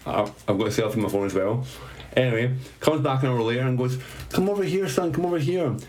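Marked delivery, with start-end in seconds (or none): 4.3-5.8 high-pitched imitation of an older lady; calling him over. Mother-in-law calls him over to meet a doctor, imitated high call. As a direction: beckon someone over, pitched well up.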